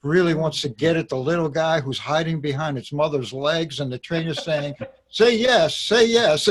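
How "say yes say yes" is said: high, loud imitation of a trainer; pitched-up, pushy coaxing; mock trainer urging. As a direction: urge it loudly, imitating an insistent trainer. Imitates trainer urging dog owner.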